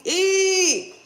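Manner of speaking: loud, held, high-pitched squeal; high "eee" squeal. Acted. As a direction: squeal it out in mock disgust. Mocking noise at poverty choice.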